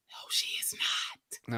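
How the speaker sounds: slow whispered denial. Real, not acted. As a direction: hush it like a secret aside. Dismissing cast member's sweetness.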